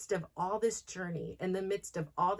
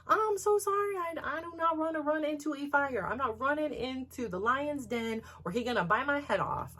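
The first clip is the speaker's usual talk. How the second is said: mock him with a shrill fake apology; sarcastic apology; 8 semitones higher than usual. Mock reply to husband's complaint.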